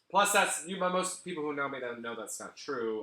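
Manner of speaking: normal talking voice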